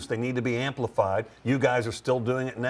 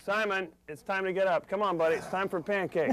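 put-on gently waking a child; 6 semitones higher, 3 dB quieter than usual. Father waking son in skit.

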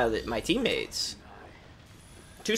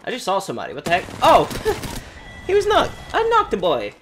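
normal talking voice

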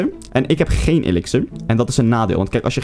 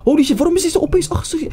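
shocked squeak. Real, not acted. Gamer shocked at the sudden attack, high squeal. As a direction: voice jumps way up in disbelief. Opponent suddenly turns aggressive.

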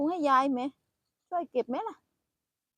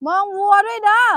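louder, higher call across the field; 7 semitones higher, 10 dB louder than usual. Real, not acted. Grandma calls to grandchild to watch the cows, loud and high.

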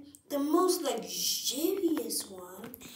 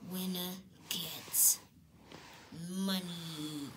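whispering; 2 semitones lower, 9 dB quieter than usual. Acted. Quick whispered aside.

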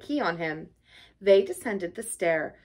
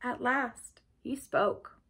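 usual speaking voice

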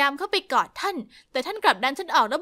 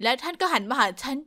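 plain reading voice

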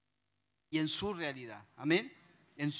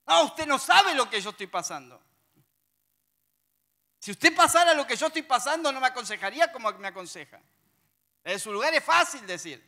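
louder, higher indignant objection; mock complaint; 5 semitones higher, 7 dB louder than usual. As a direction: throw back the complainer's protest, loud. Queja imitada de un hermano. (queja indignada imitada, más fuerte)

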